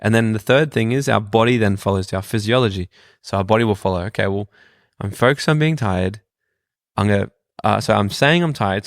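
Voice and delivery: normal talking voice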